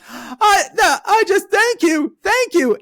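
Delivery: high, loud tearful thank you; imitating a weeping old woman's thanks; crying thanks. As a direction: choke out thanks through tears. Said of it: "She starts breaking down crying".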